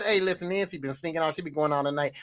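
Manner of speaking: low, hushed mock mom spinning a story; scheming mom. Mom lies to the dad about Nancy, in a low, hushed mock voice. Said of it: "you called him and said".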